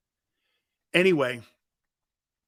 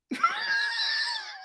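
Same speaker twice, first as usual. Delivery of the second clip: high, drawn-out laugh; uncontrollable laughing; 4 dB quieter than usual. Retold or imitated. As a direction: let out a helpless squeal of laughter. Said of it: "I couldn't stop laughing".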